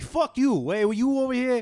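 high, loud mock New Yorker. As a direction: yell in a brash New York accent.

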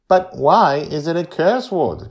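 put-on high, loud insistent child; insistent young Joy. A narrator's reading. Girl challenges her grandparents over a curse word, insistent. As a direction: press the question like a stubborn kid. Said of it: "Joy insists".

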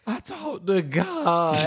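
high, slow, mock-tearful kid voice; a hammed-up sobbing boy; mock crying kid. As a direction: whine it like a weepy ten-year-old. Mock crying kid's answer.

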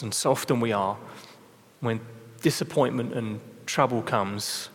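prayer. Acted. Leads closing prayer.

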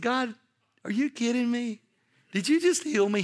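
high-pitched incredulous questioning; acted-out astonishment; mock disbelief. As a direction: ask it up high, stunned by sudden relief. Astonished retold question to God.

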